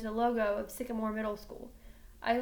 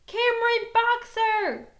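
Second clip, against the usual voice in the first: loud, high, drawn-out shout of the name; yelling a name; 10 semitones higher, 9 dB louder, slower than usual. Acted. Girl pounding on the door shouts his name. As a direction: call him out from outside the house.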